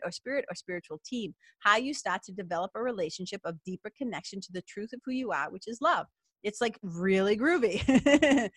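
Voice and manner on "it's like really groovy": slow, playful "groovy" drawn out; a cheeky hippie aside, slowed down. Real, not acted. Playful aside about spiritual work.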